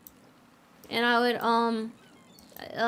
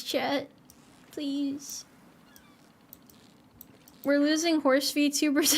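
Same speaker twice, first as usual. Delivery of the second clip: ordinary talk, nothing marked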